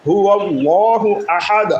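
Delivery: আহাদ ends with a bouncing দ; slow recitation, echoing the দ in আহাদ; qalqala on dal. Shown as a demonstration. Teacher showing qalqala on আহাদ: slow, bounced ending. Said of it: "ডালকে আমরা কলকলা করব".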